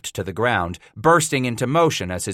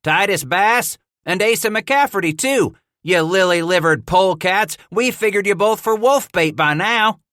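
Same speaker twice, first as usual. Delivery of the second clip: higher, louder joyful roar across the camp; 9 semitones higher, 5 dB louder than usual. A narrator's reading. Jack roaring a welcome to arriving friends. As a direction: bellow a rowdy welcome to old friends. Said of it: "Jack roared".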